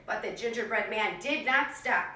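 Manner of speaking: put-on lively read-aloud for young kids; animated picture-book narration; storybook reading. A narrator's reading. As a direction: tell it like a classroom story time. Teacher reads story to class.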